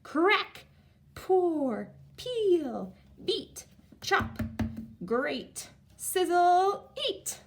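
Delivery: each action word called out high and loud; excited. Shown as a demonstration. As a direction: shout the cooking words with delight. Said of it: "'cause I love it".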